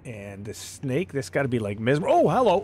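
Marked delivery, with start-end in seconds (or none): 2.1-2.6 sudden loud startled hello; startled shout. Real, not acted. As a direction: jump at the ambush. Enemy ambush startles player.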